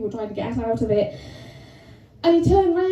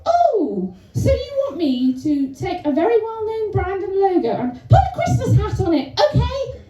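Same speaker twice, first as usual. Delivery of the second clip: high, over-eager repeating of orders; mock bright compliance; 6 semitones higher than usual. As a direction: parrot the task back in a raised pitch. Acted incredulous reply to boss.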